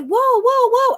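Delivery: high-pitched mock alarm; imitated alarmed warning. Daughter warning her driving mother, high alarmed cry. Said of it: "my daughter was like".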